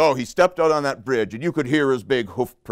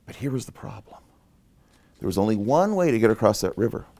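plain reading voice

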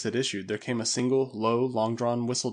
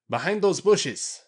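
high, loud, drawn-out warning; urgent pointing-out call; cried out; 7 semitones higher, 5 dB louder, slower than usual. A narrator's reading. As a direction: shout where the enemy is hiding. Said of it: "he cried".

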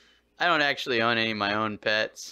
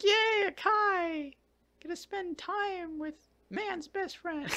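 high, slow mock cheer for the dog; mock excitement; 5 dB quieter, slower than usual. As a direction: squeal it out like a happy kid. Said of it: "I was excited".

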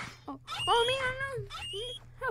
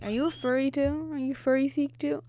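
ordinary talk

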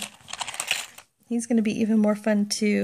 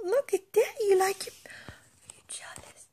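teasing whisper; 9 semitones higher, 6 dB quieter than usual. Real, not acted. Teasing the dog softly.

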